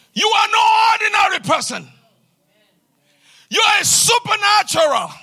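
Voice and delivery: fiery sermon climax; full-force preaching. Acted. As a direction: preach it out to the whole hall. Preaching climax, shouted praise.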